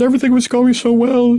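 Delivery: high, loud tearful imitation; mock crying. [name] telling the group how it went, mock crying. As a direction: wail it like a hammed-up sobber. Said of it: "And she's crying".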